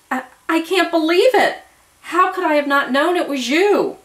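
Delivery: high, loud stammering disbelief; shocked disbelief. A narrator's reading. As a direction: blurt it out, overwhelmed.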